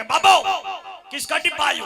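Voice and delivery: loud, high call of the ticket checker; shouting official. Retold or imitated. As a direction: bellow down the platform. Ticket checker calling out angrily. (TT की ऊँची ज़ोरदार पुकार)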